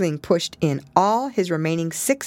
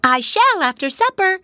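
high, firm little voice; decidedly; 5 dB louder than usual. Acted. Pigwig decides to run away. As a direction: settle it firmly. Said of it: "said Pigwig decidedly".

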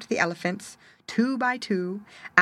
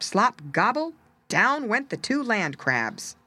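ordinary delivery, nothing marked

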